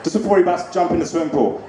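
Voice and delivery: ordinary talk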